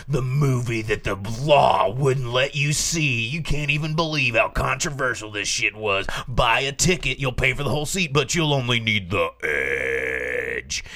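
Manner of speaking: hammed-up, booming movie promo; mock trailer voice, drawing out the final word; hype trailer announcer. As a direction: sell it like a grindhouse announcer, stretching the last word.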